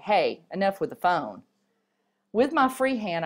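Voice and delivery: ordinary delivery, nothing marked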